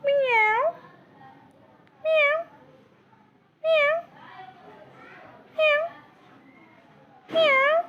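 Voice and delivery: very high imitated cat meow; squeaky playful cat miaow. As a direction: meow like a cat to tease the bird. Imitates a cat to scare the parrot.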